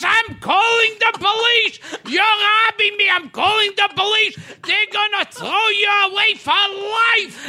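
put-on old boss shouting. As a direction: threaten her at full volume. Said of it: "He goes".

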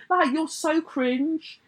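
high, slow and scornful; drawn-out disdain. Real, not acted. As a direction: drag it out in disgust. Mocking disgust.

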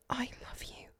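a last goodbye under the breath; whispered. A narrator's reading. Eleonora's final words to Eli, whispered. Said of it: "she whispered".

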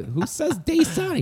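high-pitched, slow disbelief; incredulous question. Retold or imitated. Comic griping about the director's correction, incredulous high voice. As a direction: pitch way up, drawing out "Dasani" as DAY-sah-nee.

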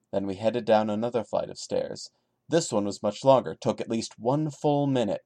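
steady, subdued creepy-story reading; story narration. A narrator's reading. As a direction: read it straight like a campfire tale. Reads creepypasta story aloud.

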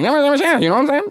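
high, rapid slurred gibberish; mock fast, unintelligible talk; garbled rapid mumble. Shown as a demonstration. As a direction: rattle it off as one blurred word. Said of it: "everything just come out like".

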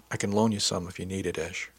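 usual speaking voice

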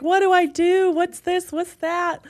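high, frantic questions; mock panic. Texting mom friends, high mock panic. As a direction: squeal the questions in mock alarm. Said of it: "and I was like".

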